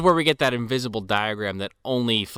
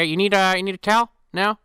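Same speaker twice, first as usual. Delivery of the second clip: high, loud put-on duck voice; squeaky duck attendant; 9 semitones higher, 6 dB louder than usual. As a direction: offer towels like Howard. Howard offering towels.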